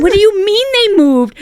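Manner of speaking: high, loud disbelief; can't believe the excuse; incredulous. Retold or imitated. Mother hearing the dog's owners moved, high loud disbelief.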